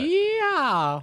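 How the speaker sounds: high, loud happy yell; excited cheer. Real, not acted. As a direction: cheer along. Cheering about last night's game.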